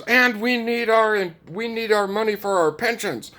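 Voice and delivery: hammed-up imitation begging; mocking Zelensky. Zelensky asking Americans for pension money, mock high whine. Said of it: "and he goes".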